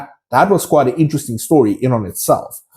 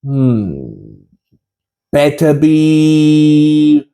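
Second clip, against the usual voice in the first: Sorting Hat voice; 2 semitones higher, slower than usual. Retold or imitated. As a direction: drawl the doubts, then burst out the house name. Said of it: "my impression of the famous Sorting Hat voice".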